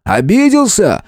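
mocking, high, drawn-out jeer; taunting. Fighter taunts the monster before swinging, taunting.

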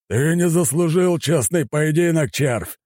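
slow, high-pitched furious bellow. A narrator's reading. Admiral to his dying enemy, bellowing with contempt. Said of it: "заорал он ещё живому врагу".

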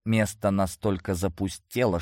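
ordinary delivery

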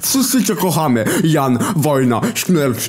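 high furious shouting; angry shout. Acted. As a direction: yell it in frustration. Hitler yelling.

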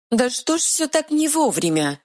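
high, exasperated fairy voice; tiny fairy about to swear; annoyed pixie. Acted. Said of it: "едва не заматюкалась фея".

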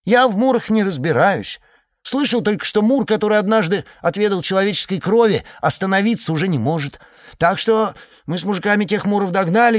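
high, lively village gossip voice; chattering villager. Acted. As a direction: talk like an excited simple iceman. Iceman character voice.

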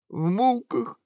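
high, mock-tearful whimper; sobbing. A narrator's reading. Prince reporting the ogre's death, sobbing. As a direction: whimper it through acted tears. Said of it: "всхлипнул принц".

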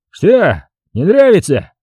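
high-pitched, picking a fight; bristling challenge. A narrator's reading. Drunk colonel to smirking men at the next table, bristling. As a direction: throw it out as a provocation. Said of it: "набычился он".

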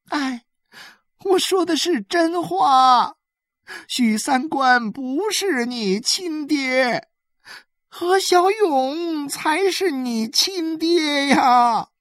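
high, slow, tearful cry; wailing insistence. A narrator's reading. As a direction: drag the words out as a woman pleading in tears. Woman wails her claim to the boy.

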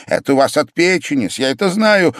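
high, quick, eager burst; suddenly animated. A narrator's reading. Pavel telling the sick man it's his liver, suddenly animated. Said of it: "оживился вдруг ужасно Павел Павлович".

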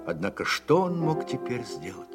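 ordinary talk, nothing marked

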